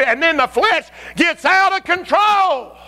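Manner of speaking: slow, high preaching shout. Acted. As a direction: bring it up to a full roar.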